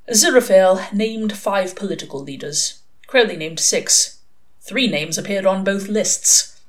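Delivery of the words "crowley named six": ordinary delivery, nothing marked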